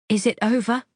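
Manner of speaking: repeating the question firmly; insistent. A narrator's reading. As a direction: demand a straight answer. She repeats, demanding a real answer.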